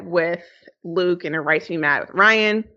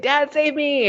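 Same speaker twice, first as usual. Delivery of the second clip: high, imitated plea for rescue; mock freak-out; 7 semitones higher, slower than usual. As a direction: lightly act out a panicked cry for help. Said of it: "freaking out when Mary's got him strung up".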